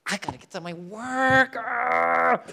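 hammed-up exasperation, rising into a loud groan; impatient outburst. Customer late for work at Tim Hortons, loud hammed-up exasperation. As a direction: lose patience loudly, mock frustrated.

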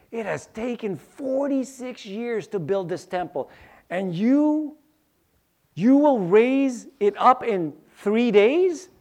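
mock the idea of rebuilding in three days.

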